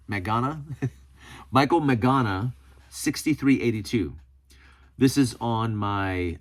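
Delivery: ordinary talk, nothing marked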